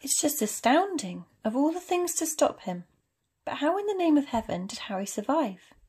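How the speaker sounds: high, loud, wondering disbelief; astonished. Acted. McGonagall wonders how the little boy survived, astonished. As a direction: marvel in distressed amazement.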